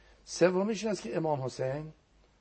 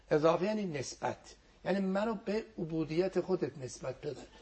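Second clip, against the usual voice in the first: ordinary delivery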